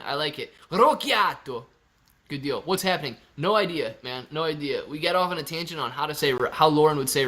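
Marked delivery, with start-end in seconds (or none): 0.7-1.6 higher mock-Italian Rocchiato; Italian accent. Shown as a demonstration. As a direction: play it up as Italian. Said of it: "Rocchiato Italian".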